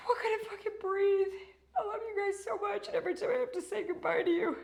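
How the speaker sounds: high, choked-up voice close to crying; fighting back tears while saying goodbye; emotional farewell to viewers, tearful and breaking. Real, not acted. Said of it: "I'm losing my voice".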